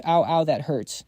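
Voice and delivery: usual speaking voice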